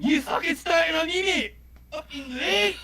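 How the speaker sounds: loud, high Glaswegian yell; drunk Glasgow shout. Retold or imitated. Drunk Glaswegian shouting at a lamppost in thick Glasgow accent. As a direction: bellow it like a drunk Glaswegian picking a fight. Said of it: "shouting at a lamppost going".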